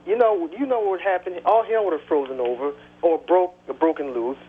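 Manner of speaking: usual speaking voice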